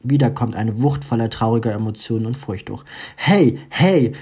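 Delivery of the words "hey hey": higher, louder calling hey hey; urgent hey hey called out. A narrator's reading. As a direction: call to snap her out of it. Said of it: "ruft das unbekannte Mädchen".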